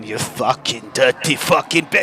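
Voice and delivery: low, soft seething under the breath; angry little creature's private muttering; muttered creature cursing. Acted. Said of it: "just hear him going".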